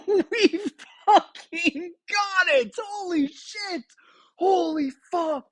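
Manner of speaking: high, slow, laughing exclamations; ecstatic swearing. Real, not acted. Gamer swearing in disbelief at a perfect roll, ecstatic. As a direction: lose it completely with joy. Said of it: "I'm giddy right now".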